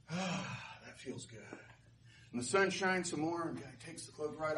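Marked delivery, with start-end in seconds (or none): 0.0-1.4 soft, breath-filled contentment; relieved sigh. Acted. Man enjoys the warm sun.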